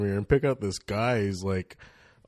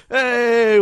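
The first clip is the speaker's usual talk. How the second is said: loud, high, cheerful greeting; a big high pop-out hello; excited hey; 14 dB louder than usual. Retold or imitated. Buddy pops out of trunk.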